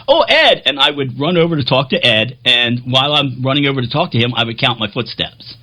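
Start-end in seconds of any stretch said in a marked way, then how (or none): 0.1-0.6 high, louder greeting call. Retold or imitated. Spotting Ed backstage and calling to him, high and loud. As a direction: call across backstage to a friend.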